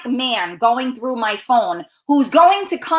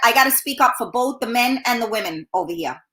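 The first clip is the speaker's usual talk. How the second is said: ordinary delivery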